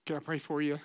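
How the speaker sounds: usual speaking voice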